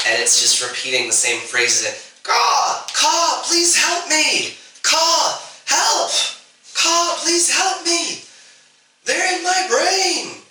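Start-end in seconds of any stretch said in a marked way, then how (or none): 2.2-10.4 high, drawn-out human cawing; distressed bird creature with a human voice, pitched way up; pleading crow-person. Acted. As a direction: plead for help in a much higher voice, slowly. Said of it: "saying it in a human voice".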